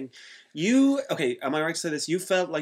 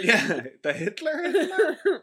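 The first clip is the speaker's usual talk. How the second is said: mock barber; 12 semitones higher, 4 dB louder, much slower than usual. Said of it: "echoing the barber again".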